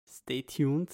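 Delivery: normal talking voice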